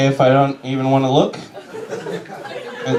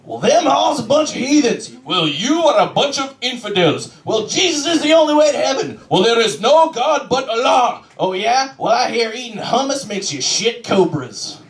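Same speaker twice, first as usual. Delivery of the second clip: squabbling believers; 9 semitones higher than usual. Retold or imitated. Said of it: "I should be like".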